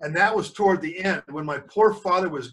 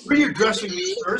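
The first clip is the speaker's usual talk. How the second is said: a hint of a cool, higher doctor; lightly voiced doctor; 8 semitones higher, faster than usual. A narrator's reading. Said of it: "said the doctor".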